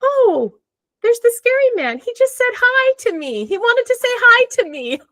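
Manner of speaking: put-on high, sweet little girl. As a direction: voice a small child telling her news. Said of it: "the daughter was like".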